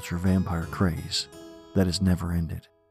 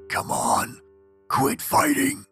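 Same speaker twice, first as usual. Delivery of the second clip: whisper, then a much higher wail; horrifying ghost voice; much slower than usual. A narrator's reading. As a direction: shriek it like a ghost. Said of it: "her voice only becomes more horrifying".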